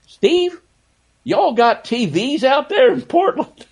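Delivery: high, drawn-out West Virginia drawl; mock the West Virginia drawl, stretching y'all. Host imitating Rod Thorne teasing Snapper, in a West Virginia drawl. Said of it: "in his West Virginia drawl".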